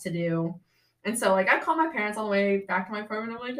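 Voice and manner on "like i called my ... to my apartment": ordinary talk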